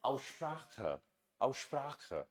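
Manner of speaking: Aussprache with a clear German ख in the middle, softly; German pronunciation demo. Shown as a demonstration. Teacher models the full German word for the class. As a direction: sound out Aussprache like a native, quiet and careful. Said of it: "So complete ये क्या हो गया?".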